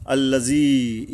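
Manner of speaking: আল্লাযী with the ী held one alif long; elongated ya. Shown as a demonstration. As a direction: stretch the ী in আল্লাযী for one count. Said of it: "ইয়ার উপরে এক আলিফ টানতে হবে".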